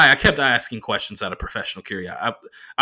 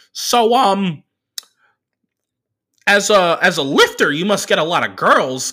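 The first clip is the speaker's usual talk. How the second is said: much higher, louder nerdy driver voice; the driver's voice; 8 semitones higher, 7 dB louder than usual. Retold or imitated. Comedian doing the driver's voice for the crowd, high and squeaky.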